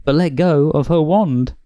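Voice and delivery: plain reading voice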